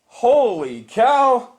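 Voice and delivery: slow, high, stretched-out amazement; stunned, thrilled exclamation; amazed elation. Real, not acted. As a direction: draw it out in happy disbelief. Still stunned by the win.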